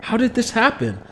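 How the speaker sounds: put-on a kid panicking about his secret; boy fearing his mom will find the grown creature, worried. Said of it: "Kamal worried".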